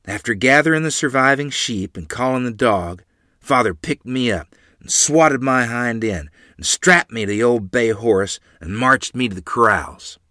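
ordinary delivery, nothing marked

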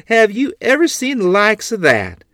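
high, loud and amused through laughter; laughing at the drunk pigs. Acted. Grandmother pointing at the staggering hog, laughing. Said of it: "a tiny ripple of laughter".

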